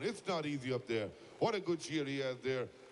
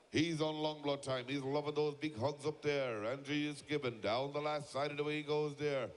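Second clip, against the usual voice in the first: ordinary talk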